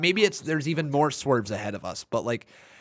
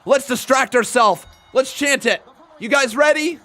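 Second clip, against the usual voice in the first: rallying call; 8 semitones higher, 5 dB louder than usual. Real, not acted. Rallying viewers for a chant.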